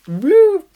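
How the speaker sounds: high, loud "woo" whoosh; playful high-pitched sound effect; imitated bow shot. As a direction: mimic an arrow flying off with a high whoop. Imitates the bow being fired.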